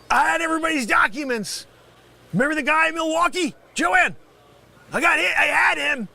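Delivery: worked-up, indignant outburst; agitated protest. Acted. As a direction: plead your lost glory to the room. Ranting over lost files.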